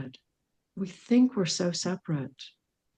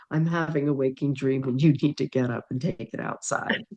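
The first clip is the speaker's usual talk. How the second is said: ordinary talk, nothing marked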